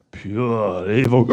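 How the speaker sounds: low, slow mock evil; mock menace. Host teasing scary show.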